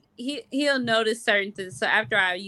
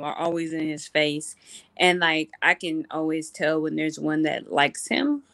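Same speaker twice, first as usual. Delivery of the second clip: normal talking voice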